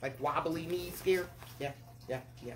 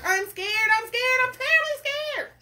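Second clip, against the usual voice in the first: very high, loud, escalating wail; terribly scared cook; 9 dB louder than usual. Acted. Cook declares his terror of fishing, hammed-up panic. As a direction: climb into hammed-up cartoon panic. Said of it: "the cook declared".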